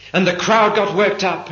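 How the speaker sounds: usual speaking voice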